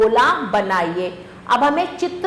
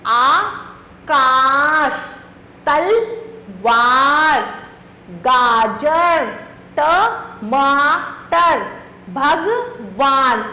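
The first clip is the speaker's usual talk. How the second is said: slow careful sounding; much slower than usual. Shown as a demonstration. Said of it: "आ की मात्रा".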